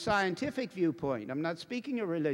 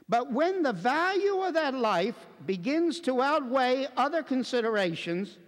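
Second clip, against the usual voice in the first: emphatic preacher's reading; 6 semitones higher, 4 dB louder than usual. Real, not acted. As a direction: read it out as damning evidence. Reads out with preaching force.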